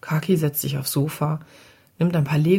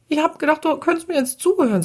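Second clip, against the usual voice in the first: much higher-pitched angry protest; indignant, cross child; 12 semitones higher, 3 dB louder, faster than usual. A narrator's reading. Sian protests that Papa isn't listening, angrily. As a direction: play an outraged child's complaint. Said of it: "wütend, ärgerlich, erbost".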